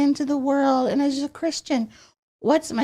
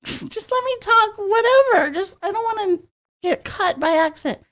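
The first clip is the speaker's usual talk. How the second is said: put-on high childish plea; 7 semitones higher than usual. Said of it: "It's like".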